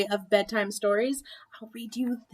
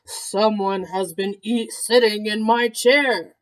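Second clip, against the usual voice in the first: slow, big Papa Bear voice, louder on chair; great big voice; 4 dB louder than usual. A narrator's reading. Papa Bear finds his chair used, big slow voice. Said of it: "said in his great big voice".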